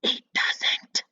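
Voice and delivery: hushed, unvoiced aside to the viewer; secret whisper. Real, not acted. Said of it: "I will tell you a little secret".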